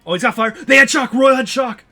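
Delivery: high, loud, urgent calls; excited shouting. Real, not acted. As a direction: yell out the action as it happens. Tense race finish, shouting.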